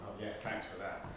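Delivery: low, flat sarcastic thanks; deadpan, dripping sarcasm. Acted.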